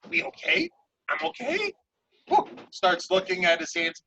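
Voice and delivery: high, squeaky little demon giggling; giddy imp voice. Acted. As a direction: play a childish imp cheering up. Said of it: "the imp kinda, like, starts standing up and goes".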